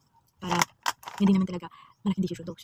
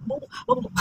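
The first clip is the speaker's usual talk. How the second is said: high, loud mock scolding of herself; self-scolding; 5 dB louder, faster than usual. Real, not acted. Vlogger scolding herself for trusting him, exasperated. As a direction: tell yourself off like a fed-up friend.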